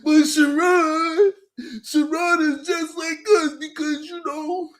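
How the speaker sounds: high whiny mock of the men; high mocking whine. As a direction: whine it like a complaining man. Mocking the men's whiny complaint.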